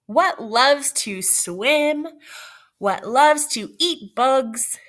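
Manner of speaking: slow, drawn-out questions; slow riddle. Real, not acted. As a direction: stretch each word for suspense. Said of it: "teacher reading riddle slowly".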